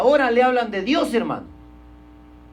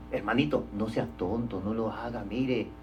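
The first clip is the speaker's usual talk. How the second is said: hushed plea; 12 semitones lower, 12 dB quieter than usual. Retold or imitated. Preacher mimics a believer hushing his brother, very quietly. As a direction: drop to a near-murmur, coaxing a foolish brother.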